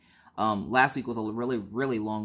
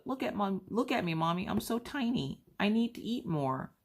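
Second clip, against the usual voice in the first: put-on much higher, softer pitiful child voice; guilt trip kid; 9 semitones higher, 4 dB quieter than usual. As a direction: pull the poor tiny me act. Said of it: "then she pulls the".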